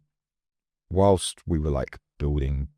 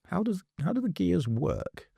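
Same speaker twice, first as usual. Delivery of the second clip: put-on high, wondering child's question; curious kid. Young self asking dad about bike gears, curious. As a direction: ask like a curious little boy. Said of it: "one day I'm like".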